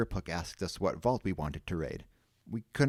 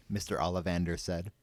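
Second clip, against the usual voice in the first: usual speaking voice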